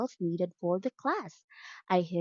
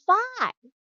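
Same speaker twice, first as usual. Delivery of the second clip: much higher, louder "Five" with a falling tune; exaggerated model; 12 semitones higher, 4 dB louder than usual. Shown as a demonstration. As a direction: sing out "Five" high so the child copies. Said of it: "Okay, say".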